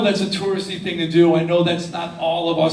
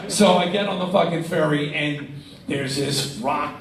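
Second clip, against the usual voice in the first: normal talking voice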